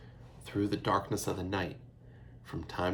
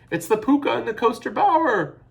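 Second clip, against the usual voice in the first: high, loud, terrified shriek; 9 dB louder than usual. A narrator's reading. Little sister shrieks in fear at the fire wagon. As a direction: shriek at the thundering hoofs. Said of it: "Eilish shrieked".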